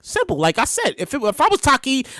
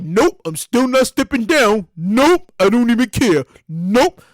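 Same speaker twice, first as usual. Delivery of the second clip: slow, stubborn mock-governor refusal; lightly voiced stubborn politician; Cuomo; 4 semitones lower, slower than usual. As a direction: drag out a smug, dismissive nope. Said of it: "Cuomo, who's like".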